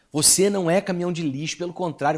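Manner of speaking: ordinary talk, nothing marked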